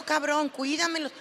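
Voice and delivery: ordinary talk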